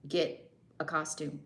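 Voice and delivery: ordinary delivery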